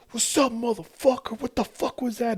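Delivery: high mocking road-rager voice; squeaky mock taunt from the car beside; other driver's taunt. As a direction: pitch it way up like the jerk driver. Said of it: "He's like".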